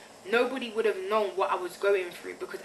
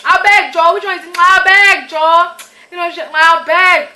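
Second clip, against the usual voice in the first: much higher, loud, dismissive "I beg"; Nigerian elder voice; 10 semitones higher, 16 dB louder than usual. Retold or imitated. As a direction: wave away the complaint, loud and high.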